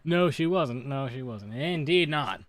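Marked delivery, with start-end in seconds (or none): 0.9-1.5 soft muttered repeat; quiet aside. Shown as a demonstration. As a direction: say it again under your breath. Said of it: "repeated softer".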